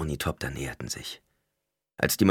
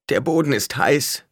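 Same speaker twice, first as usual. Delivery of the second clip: high, louder wail of complaint; complaining; 8 semitones higher, 5 dB louder than usual. A narrator's reading. A victim on the hot ground to anyone near, complaining. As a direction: complain as if hurting. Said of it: "klagte jemand".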